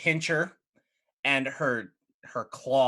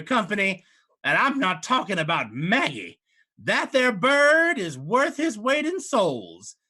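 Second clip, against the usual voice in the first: scheming hag; 4 semitones higher, 4 dB louder than usual. Acted. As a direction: purr the offer like a greedy old witch. GM voices the hag Maggie.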